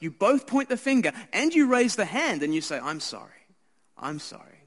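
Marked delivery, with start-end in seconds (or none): 2.8-4.6 hushed. Retold or imitated. Confessing sorrow at a death camp.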